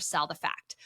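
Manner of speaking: softly whispered catchphrase; trader's punchline whispered to the listener. Shown as a demonstration. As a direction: lean in and whisper it. Trading saying finished in whisper.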